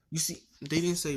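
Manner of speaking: usual speaking voice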